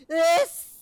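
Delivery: high, loud, slow stammering wail; agonized creature. Acted. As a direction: choke out each broken word.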